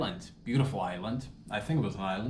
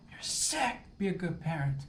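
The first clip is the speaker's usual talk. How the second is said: much higher, outraged scold; shrill scolding; 7 semitones higher than usual. Pretend. Imagined listener shrieking at him about the baby. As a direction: squeal it in mock outrage.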